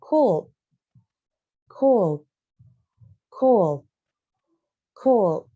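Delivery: could repeated loud and deliberate for the class; teacher modelling could. Shown as a demonstration. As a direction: say cold clearly like a teacher drilling the word. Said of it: "sekarang kita akan membahas tentang cold".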